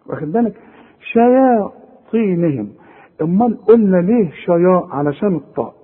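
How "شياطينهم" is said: شياطينهم drawn out slowly, light شيا then heavy ط; slow recitation of شياطينهم with a thin ya; tajweed demo. Shown as a demonstration. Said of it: "هنقول شيا".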